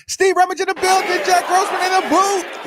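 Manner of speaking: high, shouted hype intro; booming show opener; announcer intro. Acted. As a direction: call it out like a ring announcer. Radio show intro call.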